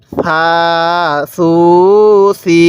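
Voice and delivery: separate, evenly sustained সা… সু… সি; drawn-out syllables. Shown as a demonstration. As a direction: hold each of সা, সু, সি long. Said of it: "এরকমভাবে শিখব".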